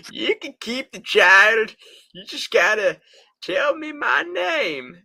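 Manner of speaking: very high, loud imp voice; fairy tale imp voice. Pretend. Imp demanding his name.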